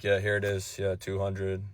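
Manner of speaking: low, soft, indifferent dude voice; mumbled low-pitched seller impression; unhelpful dude. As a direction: play a guy who can't be bothered. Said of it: "he would've just been like".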